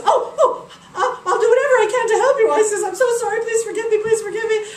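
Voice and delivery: high, loud flustered pleading; panicked groveling. Acted. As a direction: beg forgiveness in a fluster. Queen grovels to Isis.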